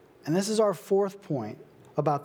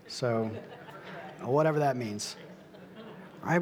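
ordinary delivery

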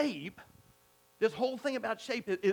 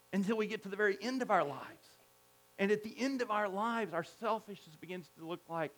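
ordinary talk

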